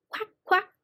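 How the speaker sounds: high duck quacks. A narrator's reading. Teacher reading animal talk to children, as a duck. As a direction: put on a little duck voice. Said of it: "said the duck".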